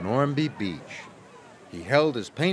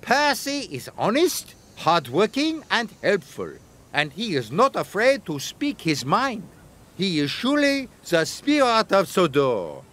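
much higher, warm praising painter; admiring artist; 6 semitones higher than usual. A narrator's reading. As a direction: list his virtues proudly.

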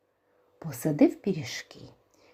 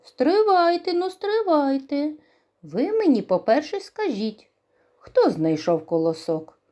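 stern rooster; 8 semitones higher, 4 dB louder than usual. Acted. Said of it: "А півник і каже".

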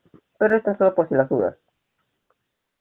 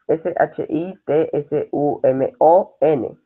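each letter of J U N B I said apart, slowly; spelling out slowly; slower than usual. Shown as a demonstration. Teacher spelling yunbi out slowly for students.

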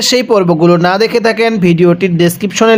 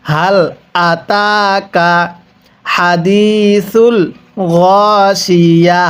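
"আতাকা" drawn out very slowly for repeating; slow recitation model; much slower than usual. Shown as a demonstration. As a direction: model each word slowly for the class to echo. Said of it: "আরেকবার পড়বেন". (ধীরে টেনে শিখিয়ে পড়া)

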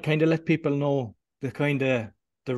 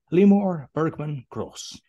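slow, louder, higher, spelling out the guest's full name; grand announcer intro; 4 semitones higher, 5 dB louder, slower than usual. Real, not acted. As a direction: announce the guest's name like a show host, drawn out. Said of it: "Did I do it justice?".

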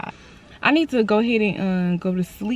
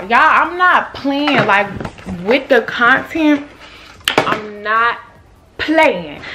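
slow, loud and higher, leaning on every word; dead serious, slow and loud; not playing, emphatic; 4 semitones higher, 6 dB louder, much slower than usual. Real, not acted. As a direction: drag it out so they know you mean it. Emphatic insistence to viewers.

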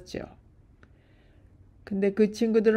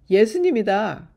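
loud, higher-pitched narrated shout; 4 semitones higher, 8 dB louder than usual. A narrator's reading. Peter recognises Jesus on the shore, shouting. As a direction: cry it out in recognition. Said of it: "하고 베드로가 소리쳤어요".

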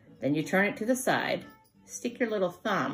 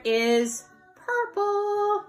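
stretched high singsong; 13 semitones higher, 3 dB louder, much slower than usual. Shown as a demonstration. Drawn out favorite color.

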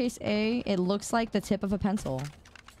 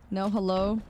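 usual speaking voice

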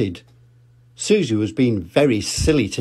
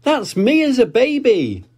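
Peppa calling; 12 semitones higher, 4 dB louder than usual. Acted. Peppa showing Daddy the photo, very high and eager. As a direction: show daddy proudly. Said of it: "said Peppa".

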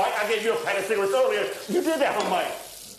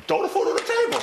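mocking echo; 14 semitones higher than usual. Mocking Mike's food complaint back.

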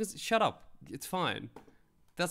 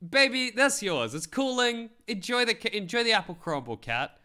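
sweet coaxing; 7 semitones higher than usual. Retold or imitated. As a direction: coax the cat like a baby. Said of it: "you just say".

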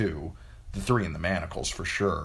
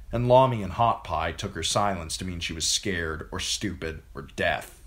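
plain reading voice

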